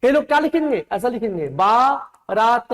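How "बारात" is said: बारात very slow, बा and रात apart; drawn-out बा...रात; wrong spelling sounded out. Shown as a demonstration. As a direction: stretch a long aa in the बा of बारात. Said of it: "गलत।".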